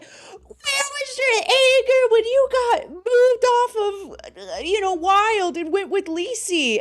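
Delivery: high, slow, nagging mimicry; mocking high whiny voice. Mocking Wonder Woman demanding where the anger was. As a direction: mimic her like a whiny accuser. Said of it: "she was like".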